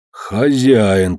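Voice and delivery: ordinary delivery, nothing marked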